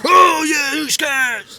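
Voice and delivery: high, loud hype-man holler; over-the-top shouted cheer; hyped intro shout. Pretend. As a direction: whoop up the show name. Co-host echoes the shouted intro.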